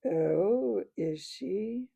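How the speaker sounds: a lightly voiced sinister creature; gray terrible voice. Hidden werewolf mocks the council, a touch sinister. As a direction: sneer it like something inhuman. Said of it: "The gray and terrible voice".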